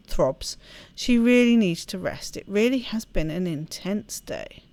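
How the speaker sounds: reading the game's story aloud; storyteller reading a visual novel; story narration. Acted. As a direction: narrate the novel text evenly. Reads visual novel text aloud.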